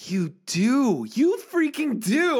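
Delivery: high gasping mock outrage; playful shocked accusing; mock shocked accusation. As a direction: act scandalised at a secret.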